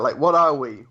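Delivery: usual speaking voice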